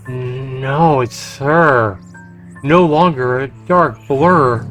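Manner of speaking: ordinary talk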